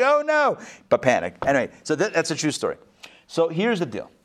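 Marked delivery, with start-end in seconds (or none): 0.0-0.6 mock alarm. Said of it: "I'm like".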